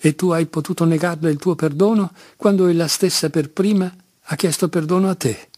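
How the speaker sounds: ordinary delivery, nothing marked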